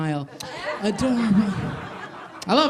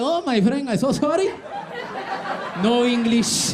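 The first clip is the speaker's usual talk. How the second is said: mock Spanish accent, pitched up, pretending no English; fake Spanish accent; 6 semitones higher, slower than usual. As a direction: play dumb in a hammed-up Spanish accent. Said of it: "we'll pretend we don't speak English".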